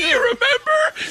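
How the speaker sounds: very high, slow words squeezed through laughter; helpless laughter in the voice; real laughing. Real, not acted. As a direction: tell it while cracking up. Cracking up at own story.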